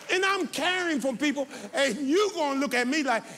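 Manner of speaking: high, loud preacher's scolding; fired-up pulpit reprimand, pitch and volume climbing; real angry rebuke. Real, not acted. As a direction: tell the congregation off at full voice. Scolds congregation for walking out.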